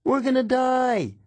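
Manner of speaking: very high panicked cry; panicked yell. A narrator's reading. Dazed passenger panicking after the crash, high yell. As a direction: scream it like a frightened passenger. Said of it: "running around in circles yelling".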